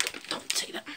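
hushed, urgent aside; whispered correction. Real, not acted. Vlogger stopping the helper mid-line, whispering. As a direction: whisper it quickly off camera.

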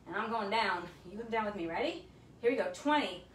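ordinary talk, nothing marked

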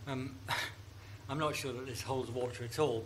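ordinary talk, nothing marked

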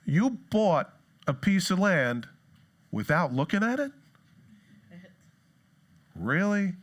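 incredulous, spacing out the words; mock incredulous. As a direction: question it as if you can't believe it. Mock questioning of a flimsy excuse.

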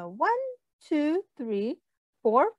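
ordinary talk

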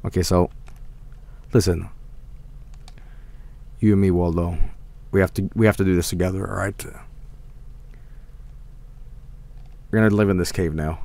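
low, soft, confiding voice; hushed pep talk. Pretend. Gamer addressing Waldo as a partner, low hushed confiding. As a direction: drop low and quiet, as if confiding.

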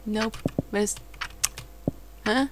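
usual speaking voice

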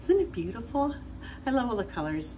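excited. Real, not acted. Showing off her salad.

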